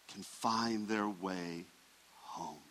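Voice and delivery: slow, dropping to a hush on home; gentle trailing close of the thought; fading hush. Real, not acted. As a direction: let it sink to almost nothing. Sermon closing line, fading.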